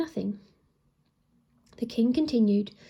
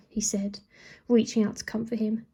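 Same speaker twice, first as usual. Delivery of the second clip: plain reading voice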